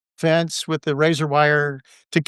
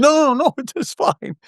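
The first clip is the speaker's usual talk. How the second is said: laughing reassurance; 11 semitones higher than usual. Retold or imitated.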